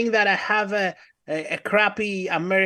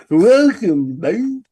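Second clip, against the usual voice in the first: loud, put-on welcome; hammed-up host greeting in a put-on voice; Artie impression; 7 dB louder, much slower than usual. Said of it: "do it in my kind of way".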